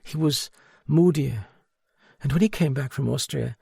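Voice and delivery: high, small and broken; lightly voiced broken woman. A narrator's reading. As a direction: let the self-pity crack through. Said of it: "She talked in a small, broken voice".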